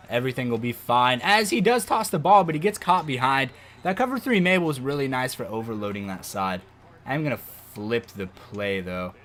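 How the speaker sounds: normal talking voice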